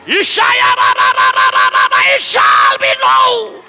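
building to a high loud cry; preaching shout. Real, not acted.

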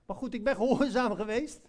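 usual speaking voice

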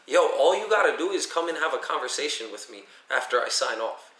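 ordinary talk, nothing marked

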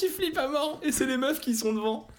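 high, amused teasing; mock the coward, pitch up. Real, not acted. Teasing the scared character.

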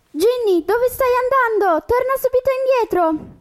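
high-pitched call after the tiger. Acted. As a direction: call her back, urgent. Calling after the runaway tiger.

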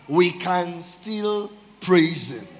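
very slow, words set apart; slow declaration. Real, not acted. Preacher announcing final praise point, slow weighty declaration. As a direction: hold each word for weight. Slow repeated title phrase.

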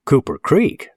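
high, rising questioning repeat; incredulous echo. Acted. Local boy doubting the stranger's home town, incredulous. Bill echoes it, frowning, in doubt.

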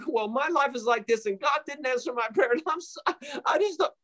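high, rising mock complaint; self-pitying whine. Imitating people who won't rejoice, mock self-pitying whine.

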